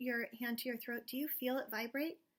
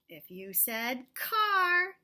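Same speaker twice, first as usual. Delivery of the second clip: slow; 6 dB louder, much slower than usual. Acted. Teacher stretching the answer.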